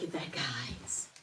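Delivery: soft unvoiced whisper; hushed whisper. Real, not acted. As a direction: breathe the word. Hushed aside while lifting.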